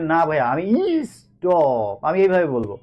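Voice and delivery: slow, stubborn mock student insisting on his own ই stop. Teacher mocking a student who says ই stop, slowly and stubbornly. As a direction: play a student who refuses the right pronunciation. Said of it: "এখন আপনি বলেন".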